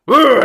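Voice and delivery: loud, high ogre growl; sudden roar of effort; ogre grunt. Acted. Poked ogre lunges at the cage, growling. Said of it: "the ogre kind of goes".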